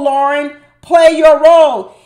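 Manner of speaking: exasperated yell at a reality show cast member; yelling at the screen. Real, not acted. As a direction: shout it at the TV like a frustrated fan.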